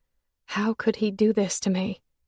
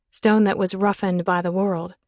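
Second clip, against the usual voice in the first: ordinary delivery, nothing marked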